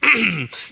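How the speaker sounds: short, put-on throat clear; mock ahem before speaking; imitated throat clearing. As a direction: clear your throat like the man approaching. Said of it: "clears his throat".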